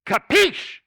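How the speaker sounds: high, loud mock Italian capiche; playful mock-Italian capiche; Italian-style capiche. Shown as a demonstration. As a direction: toss out capiche like a Mafia boss. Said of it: "they taught me the word".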